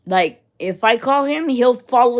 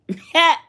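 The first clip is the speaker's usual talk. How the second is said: a short rising laugh; high laugh; 8 semitones higher, 4 dB louder than usual. Real, not acted. Laughing after joking about coming unglued, a short high laugh. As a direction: laugh at your own joke.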